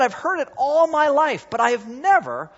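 Wesley amazed. Retold or imitated. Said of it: "He said".

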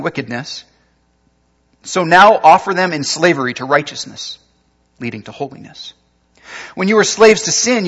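scripture reading. Acted. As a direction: proclaim the passage to the congregation. Reading Romans aloud.

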